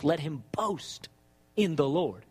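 low, drawn out, BOAST stretched long; slow right down and drop low, pausing after BOAST; scripture quoted slowly with a long BOAST; slow emphatic quote. Shown as a demonstration. Quoting scripture, first take slow.

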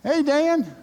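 high, bright friendly call of the name; friendly passing hello; youth pastor greeting. Retold or imitated. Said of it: "my youth pastor came by".

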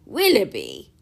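loud surprised exclamation of the name; a hearty cry of recognition; cried out. A narrator's reading. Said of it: "cried Sir John".

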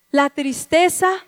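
ordinary talk

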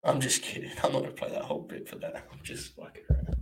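softly voiced, half-whispered retraction; muttered aside. Real, not acted. Streamer backing off a joke to chat, muttered. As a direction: mumble the take-back to yourself. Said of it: "My faults".

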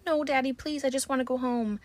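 high, desperate plea; pleading daughter. Retold or imitated. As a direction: beg her father, high and tearful-sounding. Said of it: "she's like".

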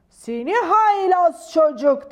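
hammed-up angry uncle; thundering scolding. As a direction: boom it out like a cross king. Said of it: "Gürledi".

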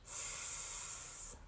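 long voiceless boa hiss; soft, drawn-out sibilant hiss; snake hiss. Acted. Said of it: "a boa constrictor hissing".

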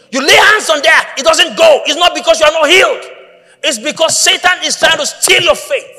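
high, loud preacher's cry; preacher urging the congregation; preaching at full force. Real, not acted. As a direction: hammer it home to the church.

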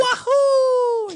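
high, loud Mario cheer; shouted Mario wahoo, pitched very high; Mario whoop. Acted. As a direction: whoop like the plumber jumping. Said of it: "I do a better Mario".

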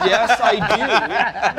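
slow, laughing yes; drawn-out laughing confession; laughing admission. Real, not acted. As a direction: own it with a sheepish laugh. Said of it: "admits nerd collection, laughing".

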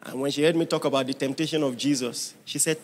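ordinary delivery

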